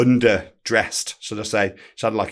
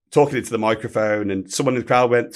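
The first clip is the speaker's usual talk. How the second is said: ordinary talk, nothing marked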